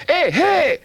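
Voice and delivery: high, loud pained yelps; yelping. A narrator's reading. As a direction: yelp as the claws bite. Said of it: "Raccoon yelped".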